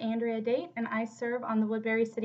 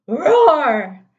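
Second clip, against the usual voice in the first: much louder, higher roar; scary roar; 4 semitones higher, 15 dB louder than usual. Acted. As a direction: let the word roar out like a beast. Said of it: "there came a very scary".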